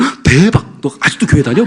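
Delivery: a gasp of mock surprise, lightly voiced; mock shocked classmate. Classmates tease a churchgoing student, mock surprised. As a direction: tease a friend with fake shock.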